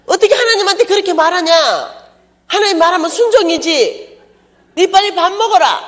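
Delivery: high, loud scolding friend's voice. Retold or imitated. Friend scolding the preacher, high loud rebuke. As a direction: scold her for talking back to God.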